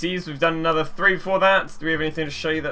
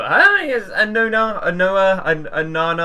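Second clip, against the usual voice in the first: delighted; 6 semitones higher, 5 dB louder, slower than usual. Real, not acted. Good card revealed.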